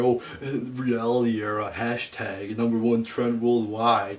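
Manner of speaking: mock the hyped fans, drawn out. Mocking Twitter fans hyping the reality era, loud sing-song. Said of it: "everybody's going, you know, crazy on Twitter".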